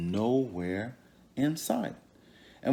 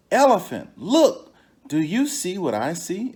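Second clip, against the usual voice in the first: higher and louder, excited trickster; Anansi calling out; 7 semitones higher, 8 dB louder than usual. Acted. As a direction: point it out with eager high excitement.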